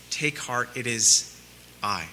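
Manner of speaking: soft, low and slow, comforting frightened friends; Jesus gently calming his terrified disciples; tender reassurance. Acted.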